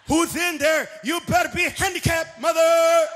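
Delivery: loud threatening yell; mock angry demand. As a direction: bark it through the stall door.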